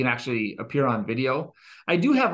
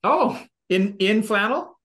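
mock surprise; 7 semitones higher, 5 dB louder, slower than usual. Teasing about wife's outfit.

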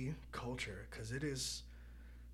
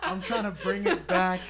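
laughing; 5 semitones higher, 10 dB louder, slower than usual. Real, not acted. Repeats line while laughing.

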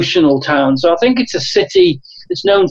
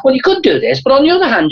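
much higher, mock-helpful argument; parodying, friendly-aggressive; 8 semitones higher, faster than usual. Coach getting people to make their own decision, parodying friendly aggression. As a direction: lift the pitch and parody giving both sides. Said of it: "kind of a parodying way of".

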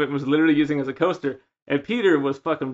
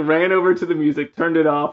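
normal talking voice

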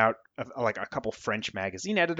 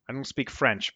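usual speaking voice

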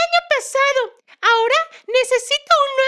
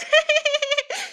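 short playful giggle; 2 semitones higher than usual. Acted. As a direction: laugh at your own joke. Laughing at her own joke.

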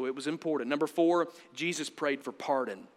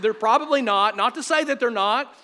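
loud, raised-pitch restatement; loud insistence; 6 semitones higher, 8 dB louder than usual. Real, not acted. Preacher restating his aside to the congregation, loud and insistent.

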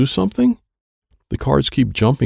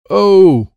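high, loud drawn-out "oh"; loud cry; 12 semitones higher, 9 dB louder than usual. A narrator's reading. Child refusing to play the game, loud cry.

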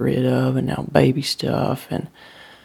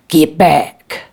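loud, forceful repeated warning; urgent command; 8 dB louder than usual. A narrator's reading. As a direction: raise the voice to halt someone. Father repeats order to stay away.

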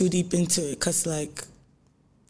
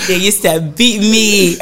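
laughing; 3 semitones higher, 5 dB louder, slower than usual. Real, not acted. Laughing while recalling school.

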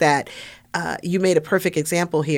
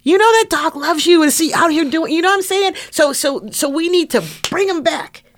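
high, urgent, emotional pleading; impassioned plea; 11 semitones higher than usual. Real, not acted. Chaplain begging listeners to bring people back, impassioned plea. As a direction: plead with the voice pitched right up. Overcome while making his plea.

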